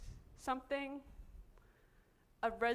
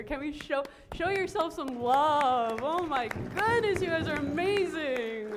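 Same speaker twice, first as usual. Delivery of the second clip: excited call to the crowd; 5 semitones higher, 7 dB louder than usual. Real, not acted. Speaker rallying the audience to cheer, excited call. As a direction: lift the whole room into applause.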